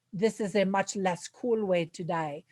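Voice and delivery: a clumsy, lightly put-on Russian accent, dry and deadpan; bad Russian accent. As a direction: toss off the line in a poor Russian accent. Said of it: "that was a terrible Rus-, Russian accent".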